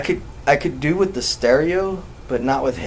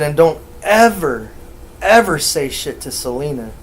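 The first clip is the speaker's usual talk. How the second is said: "ever" punched louder, then repeated; stress on ever; 3 semitones higher, 5 dB louder than usual. Retold or imitated. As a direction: hit "ever" hard as a stern warning. Retells warning, repeats ever emphatically.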